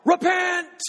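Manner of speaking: shouted high and loud; John the Baptist crying out to the people, loud; calling out. Retold or imitated. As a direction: cry it out like a prophet in the wilderness. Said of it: "He had been calling out".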